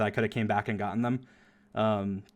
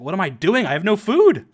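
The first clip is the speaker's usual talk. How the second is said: mock alarm, rising higher and louder; retold panic; 7 semitones higher, 9 dB louder than usual. Player reliving running out with no food, mock panic. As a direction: build to an exasperated yell.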